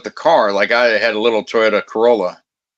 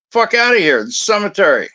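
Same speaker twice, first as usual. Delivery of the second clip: high-pitched indignant retort; exasperated, raised-pitch scolding; retold angry ordering-out; 9 semitones higher than usual. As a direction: re-enact chasing someone off. Answering people yelling and cussing at him.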